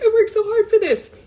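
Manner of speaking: real emotion breaking through the quote; teary. Real, not acted. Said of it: "It was really hard 'cause I was like".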